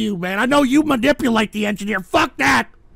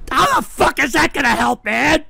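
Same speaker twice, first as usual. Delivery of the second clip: ordinary talk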